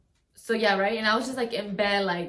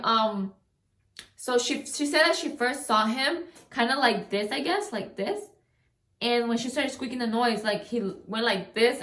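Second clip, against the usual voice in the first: ordinary delivery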